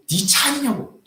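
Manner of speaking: high-pitched mock accusing old man; angry old man. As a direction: snap the question like the old man did. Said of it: "화를 내시는 거예요".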